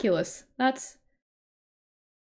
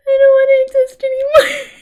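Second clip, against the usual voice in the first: mock wail; 13 semitones higher, 14 dB louder than usual.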